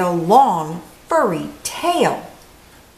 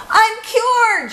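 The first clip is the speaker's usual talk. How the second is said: much higher, thrilled cry; joyful shout; 3 dB louder than usual. Acted. Camilla cured, shouting with joy. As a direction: burst out with joy. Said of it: "she shouted".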